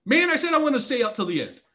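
mildly whiny protest; whiny complaint. Retold or imitated. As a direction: complain like a grumpy partner. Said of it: "Andy's like".

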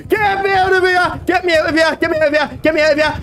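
high, frantic pleading; begging the game to stop, terrified; panicked squeals of no and sorry; real panic. Real, not acted. Streamer panicking in a horror game.

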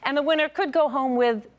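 loud upbeat host welcome; game show host. Acted. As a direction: open the show with energy.

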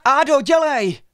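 high, loud hurry-up shout; urgent drawn-out call. A narrator's reading. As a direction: yell back to a friend lagging behind. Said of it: "volali všichni před ním".

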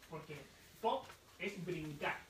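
slow down on pop to teach it; slow teaching stress. Shown as a demonstration. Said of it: "repito pop veinte veces". (énfasis lento en pop)

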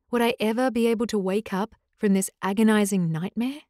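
ordinary delivery, nothing marked

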